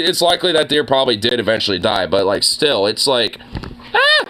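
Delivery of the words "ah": short, very high-pitched yelp; mock yelp. Reacting to imagining the deer's injury, high mock yelp. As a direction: let out a squeaky little cry of dismay.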